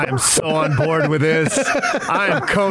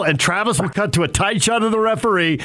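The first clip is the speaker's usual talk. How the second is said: normal talking voice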